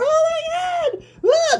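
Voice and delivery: high, loud shriek; hammed-up screaming victim; horrified scream. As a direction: shriek in full horror. Victim horror.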